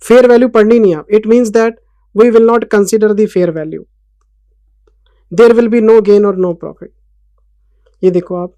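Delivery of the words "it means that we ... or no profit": slow, loud, word-by-word note dictation; teacher reading notes aloud for copying. Acted. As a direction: dictate each word slowly so students can write it.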